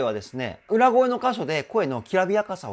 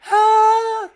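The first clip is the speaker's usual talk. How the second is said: very high and much louder punchy note; powerful mix voice; 10 dB louder than usual. Shown as a demonstration. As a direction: hit the high note with force. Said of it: "やわやわーって感じじゃなくて".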